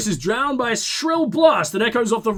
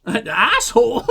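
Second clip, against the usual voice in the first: slow, amused repeat through laughter; laughing at own accent; 4 semitones higher, 3 dB louder, slower than usual. Real, not acted. Player doubting the accent just given, laughing. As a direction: laugh as you query the word. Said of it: "I don't know what accent".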